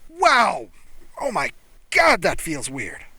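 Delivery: loud, high astonished exclaim; amazed. Acted. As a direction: blurt it out in shock. Human surprised by strange sensation.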